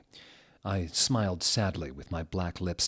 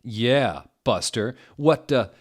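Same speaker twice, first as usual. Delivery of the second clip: protective demand; 5 semitones higher, 6 dB louder than usual. Acted. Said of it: "Draco demanded, all protective".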